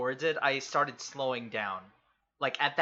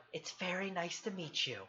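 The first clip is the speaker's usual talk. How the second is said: much softer polite greeting; 3 semitones higher, 12 dB quieter than usual. Acted. Twilight petting his tentacle, much softer politeness. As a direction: gently greet while patting. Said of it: "And I go".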